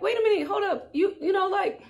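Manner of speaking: high, laughing disbelief as he drives off; startled laughing protest. Retold or imitated. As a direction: call after him, half laughing, that's my car. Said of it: "and I'm like".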